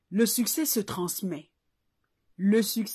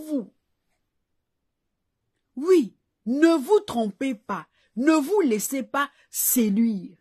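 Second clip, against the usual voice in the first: louder, insistent pulpit questions; fervent preaching; 2 semitones higher, 4 dB louder than usual. Acted. Preacher warning listeners who backs their success, forceful preaching. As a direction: hammer each question home like a preacher.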